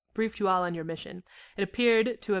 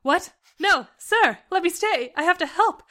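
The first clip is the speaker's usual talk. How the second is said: high, loud, urgent protest; desperate plea; 10 semitones higher, 6 dB louder, slower than usual. A narrator's reading. Liz begging the captain to let her help, desperate. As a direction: plead hard to be allowed to stay.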